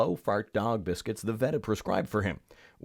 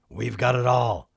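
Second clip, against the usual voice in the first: louder put-on crook voice; burglar; 5 dB louder than usual. A narrator's reading. Second burglar to partner with the loot, put-on crook voice. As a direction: announce the haul in a crook's character voice.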